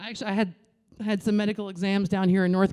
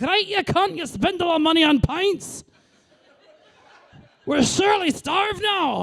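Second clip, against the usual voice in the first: put-on Scottish kid cursing; 10 semitones higher than usual. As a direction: scold like a furious little Scottish kid. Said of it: "He was like".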